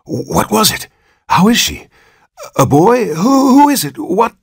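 nervous father pelts the doctor with questions; frantic questions. Acted. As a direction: panicky father rushing.

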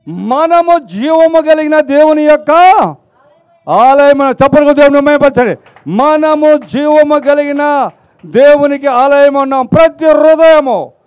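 loud, high fervent sermon delivery; preaching at full force. Acted. Pastor exhorts the congregation, loud and fervent. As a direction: thunder it from the pulpit.

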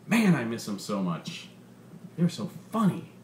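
lively, punchy comedian working the crowd; stand-up comedian. Acted. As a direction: sell the bit to a packed club. Comedian's stand-up set in story.

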